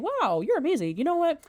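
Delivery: mock-dazzled flattery, rising high; gushing praise. [name] gushing to Todd after the contest, lightly hammed-up praise. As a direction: pitch jumps way up, gushing over the barber.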